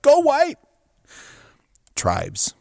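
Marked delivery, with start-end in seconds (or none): 0.0-0.6 imitated high, ringing fan reply; shouting back. Stranger answering a fellow Michigan State fan, imitated high shout back. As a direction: call back the chant, pitched way up. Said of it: "some stranger shouts back".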